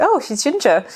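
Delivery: a surgeon caught off guard; surprised surgeon. Retold or imitated. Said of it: "they went".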